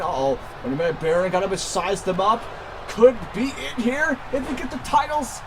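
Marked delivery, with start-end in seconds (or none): 2.9-5.4 wrestling commentator. Acted. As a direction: call the finish like a hyped announcer. Live wrestling play-by-play.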